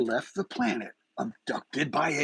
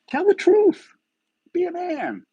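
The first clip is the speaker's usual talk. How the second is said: demanding shout; 5 dB louder than usual. Real, not acted. Demanding Chris answer on camera.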